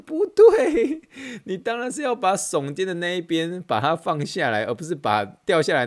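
"不对" blurted out very high and loud through laughter; laughing correction. Retold or imitated. Trainer correcting the aunt while laughing, loud and high. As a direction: laugh while setting her straight.

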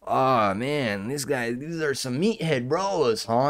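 exaggerated, singsong mock of another commentator; mocking imitation. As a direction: mimic him, overdoing the unnatural talk. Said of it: "no one really talks like that".

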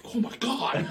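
high-pitched stunned exclamation; hammed-up amazed cry; mock astonishment. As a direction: imitate a flabbergasted guest, pitch way up. Acts the stunned guy.

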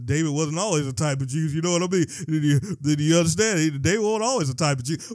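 loud, rapid-fire aside at normal pitch; emphatic aside. Real, not acted. Preacher making sure listeners understand, loudly insistent. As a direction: drive the caveat home, loud and fast.

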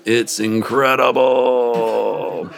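very slow, savoring each word. A narrator's reading. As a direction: draw it out with pleasure. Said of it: "My winged ass smiles".

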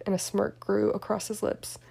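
plain reading voice

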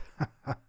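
short, low-key villain laugh; wild dog's chuckle. A narrator's reading. Wild dog imagines eating Schnuddel, short chuckle. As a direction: chuckle briefly over the next meal.

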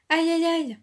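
high, loud acted yelps; exclaiming in pain. A narrator's reading. Rat inside the beaten gourd, yelping in pain. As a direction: yelp as if being struck. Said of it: "le rat s'exclama".

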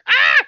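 very high, loud shriek; mock scream. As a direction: imitate a startled girl's scream. Retold scream of startled girl.

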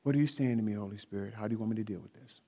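soft, low, quickening murmur to God; hushed question to the Holy Spirit; quiet inward prayer. Acted. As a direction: ask softly and low, as if praying privately. Models quietly asking the Holy Spirit.

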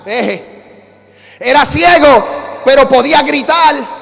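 loud, punched preaching refrain; forceful preaching. Real, not acted. Preacher telling the church what the blind man could do, forceful preaching. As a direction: drive each short phrase out to the congregation.

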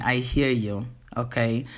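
normal talking voice

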